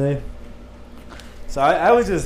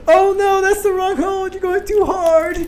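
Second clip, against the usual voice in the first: mock moaning. Coworker pranking a scam caller on the phone, mock moaning. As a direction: wail it high in hammed-up ecstasy. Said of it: "He keeps fucking moaning".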